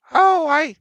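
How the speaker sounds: very high, wavering-pitch granny voice; old woman. Acted. Old woman answers visitor about food, in high granny voice. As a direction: start way up high as old Nanny, then settle.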